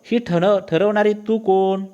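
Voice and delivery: husband's indignant challenge, pitched high; indignant retort. Acted. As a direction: build to an affronted comeback. Husband retorts angrily in acted quarrel.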